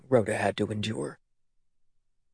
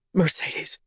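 unvoiced hoarse whisper; 5 semitones higher than usual. A narrator's reading. Mother whispering her daughter's true name. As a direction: rasp the name with no voice. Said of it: "she whispered hoarsely".

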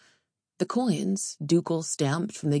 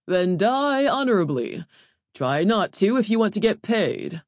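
curt overseer; 4 semitones higher, 4 dB louder than usual. Acted. Overseer answers.

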